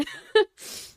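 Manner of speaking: airy laugh through tears; teary breathy laugh. Real, not acted. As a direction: laugh while crying. Crying, laughing at own tears.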